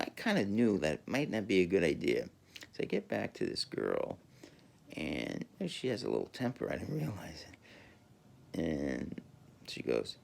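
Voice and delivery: normal talking voice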